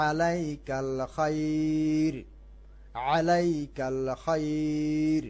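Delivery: slow, with খাইর stretched by one alif; drawn-out recitation. Shown as a demonstration. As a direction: lengthen খাইর evenly. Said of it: "এক লেফট টানা যায়।".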